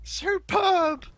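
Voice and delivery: very high, drawn-out cry of amazement; awed excitement. Real, not acted. Commentator hails match-winning air rockets, high awed excitement. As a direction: marvel at the winning shot, high and stretched.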